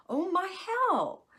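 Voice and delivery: high, loud and slow mock wail of despair. Mocking her own spiralling self-talk, a high despairing wail. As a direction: wail it like the last straw.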